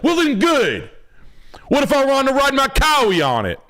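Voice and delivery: very high, smug builder voice; high-pitched smug comeback; mock builder. Builder answering the critics, high and smug. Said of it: "He's like".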